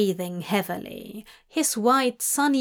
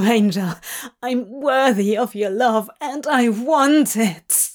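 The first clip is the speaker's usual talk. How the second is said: loud, overwrought cry; 4 dB louder than usual. A narrator's reading. Demon declaring he is worthy, crying out emotionally. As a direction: blurt it out, breaking into cries. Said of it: "he cried".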